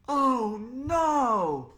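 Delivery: high, loud cry of dismay; dismayed holler. Acted. Class hearing about the lawsuit, loud dismayed cry. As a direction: wail it in dismay. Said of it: "we all hollered".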